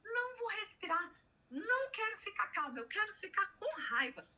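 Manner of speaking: put-on high-pitched defiant child protesting; angry little girl. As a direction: play the stubborn small daughter who refuses to calm down. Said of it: "ela tava com raiva da de dezoito, chorando".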